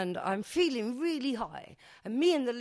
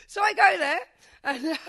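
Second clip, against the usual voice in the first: excited restart; 10 semitones higher, 10 dB louder than usual. Real, not acted. Laughing restart after joke.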